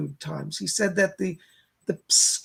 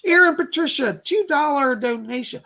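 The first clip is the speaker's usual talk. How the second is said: cheerful announcement; 5 semitones higher, 5 dB louder than usual. Acted. Announcing a donation.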